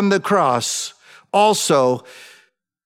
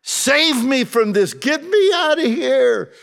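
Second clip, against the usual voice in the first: Jesus crying out to the Father, higher and strained; pleading prayer; 7 semitones higher than usual. Acted. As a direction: beg to be taken away, voice lifted high. Preacher voices Jesus pleading in prayer.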